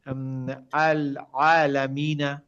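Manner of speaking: আলামিনা said slowly with the Arabic ع drawn out; slow Arabic recitation. Shown as a demonstration. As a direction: stretch out আল আলামিনা like Quran recitation. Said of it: "প্রথমে আমি উচ্চারণ করে দিয়েছি". (ধীরে আরবি উচ্চারণে আলামিনা)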